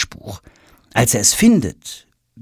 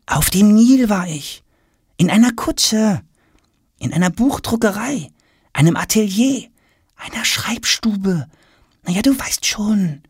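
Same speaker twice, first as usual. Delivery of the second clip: put-on hinting boy; 12 semitones higher than usual. A narrator's reading. Tom helps father guess where he was, hinting. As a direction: list the clues one by one, high and knowing.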